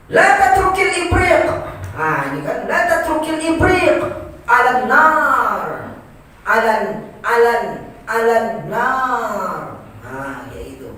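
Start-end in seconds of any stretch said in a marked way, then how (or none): 0.1-1.4 LA TATRUKIL IBRIQ pitched high and loud; high Arabic intonation. Shown as a demonstration. Teacher demonstrating Arabic intonation, high, loud "La tatrukil ibriq". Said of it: "tinggi kan intonasinya". 2.6-9.6 loud "la tatrukil ibriq", NAR stretched long; high long-vowel intonation. Shown as a demonstration. Teacher drilling the Arabic sentence, loud stretched "alan nar". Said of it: "tinggi kan intonasinya".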